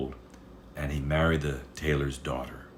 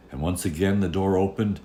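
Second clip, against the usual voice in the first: usual speaking voice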